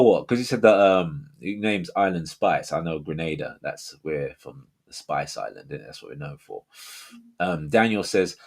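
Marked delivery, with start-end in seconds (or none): none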